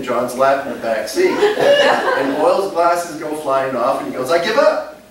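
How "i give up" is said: high, loud mock surrender; retold cry of surrender. Drunk man gives up to the trooper, loud mock surrender. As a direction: call it out like a beaten man.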